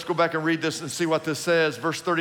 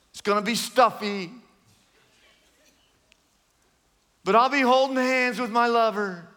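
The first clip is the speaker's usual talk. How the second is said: lightly lampoon the sinner who shrugs off hell; mocking carefree sinner; 6 semitones higher than usual. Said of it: "And so they go".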